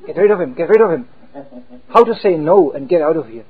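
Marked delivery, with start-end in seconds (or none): 0.1-1.1 urgent inner voice. Retold or imitated. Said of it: "this crippled intelligence behind".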